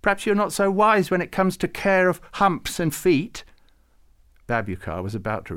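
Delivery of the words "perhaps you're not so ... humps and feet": much higher, louder huffy jab; indignant. A narrator's reading. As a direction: take offence and hit back. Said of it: "said Farouk indignantly".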